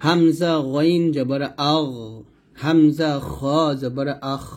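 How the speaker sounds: slow spelling-out with thick, full 'আগ' and 'আখ'; heavy ghain, kha. Shown as a demonstration. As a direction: make the 'গ' and 'খ' sounds heavy. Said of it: "গয়েনটা এবং খটা মোটা করে". ('গইন' আর 'খ' মোটা করে ধীরে উচ্চারণ)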